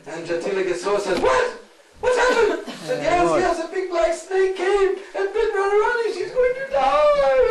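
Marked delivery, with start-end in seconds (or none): 1.2-2.6 alarmed cry. Acted. Jatila hears shocking news. 3.0-7.5 panicked shout. Acted. Lalita repeats the snakebite news.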